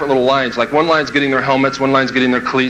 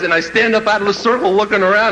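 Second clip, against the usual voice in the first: usual speaking voice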